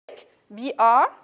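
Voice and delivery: break, spelled out B R letter by letter; spelling out a word. Shown as a demonstration. Teacher spelling out break for students. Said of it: "Break, uh".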